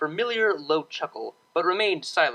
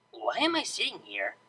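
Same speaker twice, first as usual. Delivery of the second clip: muttered; 4 semitones higher, 6 dB quieter than usual. A narrator's reading. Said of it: "she muttered".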